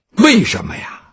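ordinary delivery, nothing marked

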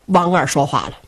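ordinary delivery

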